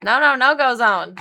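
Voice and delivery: loud stern call at a dog; big voice holler. Retold or imitated. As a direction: holler him off the rabbit. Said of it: "Diesel got a big voice holler".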